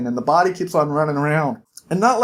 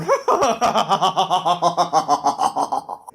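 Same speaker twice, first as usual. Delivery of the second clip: hammed-up villain laugh; evil cackle. Devil gloats over Jack's soul with a cackle.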